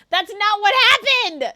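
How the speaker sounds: high, very loud objection; protesting shout. Real, not acted. Streamer rebutting teasing about lyrics, protesting shout. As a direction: yell it indignantly.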